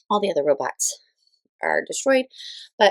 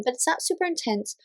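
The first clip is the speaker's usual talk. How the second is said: ordinary talk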